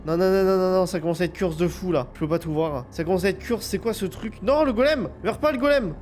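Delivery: high loud panicked cries; panicking. Real, not acted. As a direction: scream in rising panic. Panic as the golem fights.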